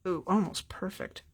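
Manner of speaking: usual speaking voice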